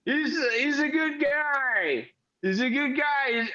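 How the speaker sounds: high, slow, slurry fondness; drunk praise. Acted. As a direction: gush like a tipsy barfly. Drunk Randy praises Nico.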